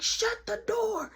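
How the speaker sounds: high, drawn-out command; slow, high-pitched acted demand; exasperated order. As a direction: stretch it out, fed up. Exasperated order during quarrel.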